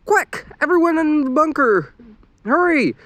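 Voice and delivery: high, urgent panicked call; frantic. A narrator's reading. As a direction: rush everyone in a panic. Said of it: "Lisa said frantically".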